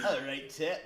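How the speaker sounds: amateur mock British accent. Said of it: "do a, a British accent".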